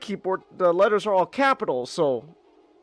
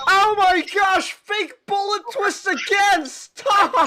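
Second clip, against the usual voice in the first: exasperated outcry; 10 semitones higher, slower than usual. Real, not acted. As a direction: protest the game's cheap trick. Reacting to a game twist.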